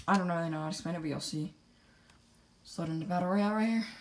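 ordinary talk, nothing marked

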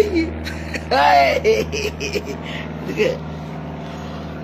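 long, trailing cackle; long laugh. Real, not acted. Streamer mocking her critics, long cackle. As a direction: let a drawn-out laugh tail away.

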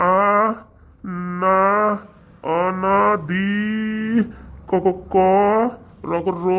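spelling out syllables one by one with long pauses; halting reading. Acted. Brahmin sounding out hard Sanskrit, halting and slow. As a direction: stumble through the text letter by letter. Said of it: "এত সংস্কৃত কঠিন".

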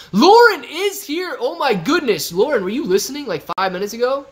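loud, high, thrilled shout-out; excited, high-pitched hollering that eases slightly; excited greeting. Real, not acted. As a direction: burst out as a favourite viewer turns up. Streamer hails an arriving viewer.

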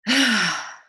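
loud open-mouthed sigh, falling away; audible exhale. Shown as a demonstration. Coach showing listeners how to release tension, audible exhale. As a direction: let the breath out audibly through the mouth. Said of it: "breathe audibly out your mouth".

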